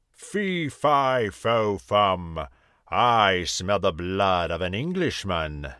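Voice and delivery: a hammed-up giant bellowing his rhyme; giant's roar. A narrator's reading. Giant sniffing for an intruder, mock roar. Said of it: "roared the giant".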